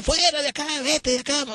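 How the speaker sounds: very high, sharp driving-out cry; retold yell of the priest. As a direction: chase him out. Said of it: "me gritaba de acá".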